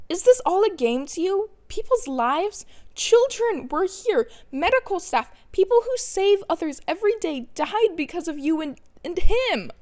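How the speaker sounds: a grief-stricken rage that builds to the last word; furious outburst. Acted. Izuku confronts Kurogiri over the dead.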